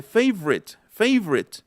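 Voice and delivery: 'favorite' as FAV-rit, twice; vanishing middle vowel. Shown as a demonstration. Teacher giving an example, 'favorite' in two syllables. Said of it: "la O intermedia también desapareciera".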